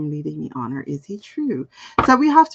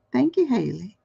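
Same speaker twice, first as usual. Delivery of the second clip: ordinary delivery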